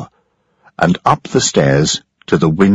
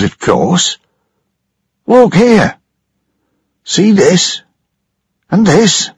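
an anxious ship's engineer; frightened engineer; 11 semitones higher than usual. Acted. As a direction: engineer pointing out damage over the noise.

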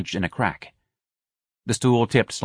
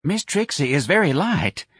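lightly voiced, higher-pitched office manager; placating manager; 8 semitones higher than usual. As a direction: mildly placate him in a tenor. Manager placating injured Ignatius.

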